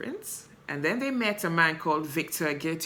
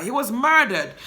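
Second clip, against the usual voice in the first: higher and louder, hammering it home; emphatic declaration; 6 semitones higher, 5 dB louder than usual. Real, not acted. Defending Michael to viewers, loud and emphatic. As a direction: declare it like a verdict.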